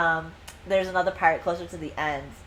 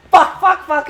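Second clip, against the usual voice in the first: frustrated cursing; 8 semitones higher, 8 dB louder than usual. Retold or imitated. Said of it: "So now he's like".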